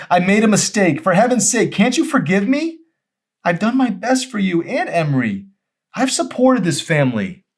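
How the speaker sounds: very high and loud, begging to be forgiven; husband cries out for forgiveness; desperate pleading. Acted.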